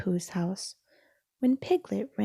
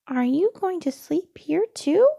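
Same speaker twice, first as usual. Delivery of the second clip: Piglet voice; 9 semitones higher, 7 dB louder than usual. Acted. Piglet asking eagerly.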